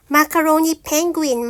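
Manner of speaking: ordinary delivery, nothing marked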